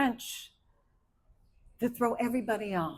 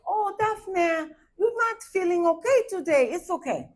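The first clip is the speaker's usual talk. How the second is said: calm Haitian mother; 8 semitones higher than usual. Retold or imitated. Mom reassuring her daughter Daphne on a bad day, calm and high. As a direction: soothe like a Haitian mom, pitched up. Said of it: "so when she was calm".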